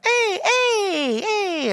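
very high, exaggerated mom squeal; hammed-up high-pitched glee; delighted mom impression. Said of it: "That's how she talks".

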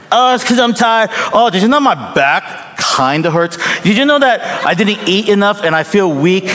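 mock whine your way out of chores; whiny excuses. Husband dodging his wife's chores, hammed-up whiny excuses.

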